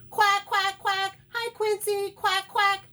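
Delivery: high, very loud quacking shout; shouting duck. A narrator's reading. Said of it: "shouts Dottie".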